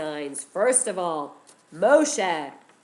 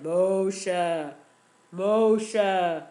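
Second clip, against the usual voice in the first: slow, loud, booming voice from the bush; powerful voice; 7 dB louder, much slower than usual. A narrator's reading. God calling to Moshe from the burning bush, slow and booming. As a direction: boom it out slowly like a divine voice. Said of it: "it sounded like a powerful voice".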